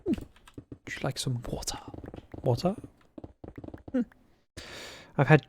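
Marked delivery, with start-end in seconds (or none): 0.0-4.0 put-on French waiter accent. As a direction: imitate a posh French waiter offering "water". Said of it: "a French waiter who said".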